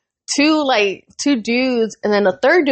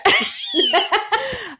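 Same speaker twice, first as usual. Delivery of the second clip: high giggle; 12 semitones higher, 3 dB quieter than usual. Real, not acted. Retelling the cashier's question, a high embarrassed giggle. Embarrassed about her flirting.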